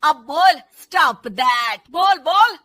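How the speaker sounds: slow, loud, taunting challenge; bully her into saying it; angry mother-in-law. Acted. Mother-in-law daring the daughter-in-law to speak, angry. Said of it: "सास को फिर बहू पर गुस्सा".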